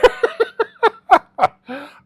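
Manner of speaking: high, loud burst of laughter; real laugh bursting out high and loud; hearty laugh. Real, not acted. Guest at host's joke about a theme, hearty laugh.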